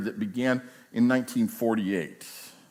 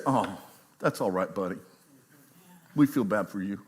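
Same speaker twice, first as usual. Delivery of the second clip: a hint of soft pity, quieter; mock coddling; 5 semitones lower, 5 dB quieter than usual. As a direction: pretend to pat him on the head. Mocking a coddling team manager.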